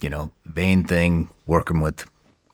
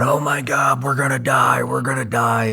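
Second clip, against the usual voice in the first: higher, frantic put-on voice; mock panic; 8 semitones higher than usual. The base chief hiding in a bathtub during the attack, higher mock panic. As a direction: ham up a scared chief.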